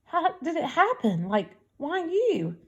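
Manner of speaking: high, bewildered questioning; dazed disbelief. A narrator's reading. Girl to an invisible boy, trying to grasp it, dazed. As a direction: ask it dazed, pitch lifted, slowing at the end. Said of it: "Looking sort of dazed".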